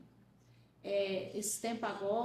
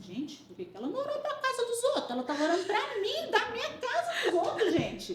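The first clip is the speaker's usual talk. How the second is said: high, louder indignant outburst with a laugh; mock fury; 11 semitones higher, 3 dB louder than usual. Narrator retelling her anger at her mother's prayer, high mock outrage. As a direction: rant up high, half laughing. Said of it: "veio uma fúria em mim".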